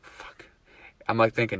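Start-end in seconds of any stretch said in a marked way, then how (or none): 0.0-0.5 whispered swear of resignation; hushed curse. Retold or imitated. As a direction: breathe the curse out voicelessly. Retold dismay at having to stop.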